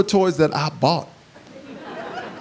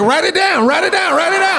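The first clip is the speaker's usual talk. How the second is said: dad's excited glee; 9 semitones higher than usual. Retold or imitated. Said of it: "My dad's like".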